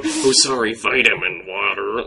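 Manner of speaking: British teacher mocking an American accent; hammed-up American accent, slowing on the last words; exaggerated American accent. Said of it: "that's just how they hear us".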